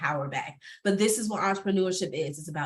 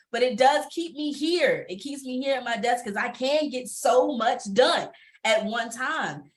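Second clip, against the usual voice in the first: ordinary delivery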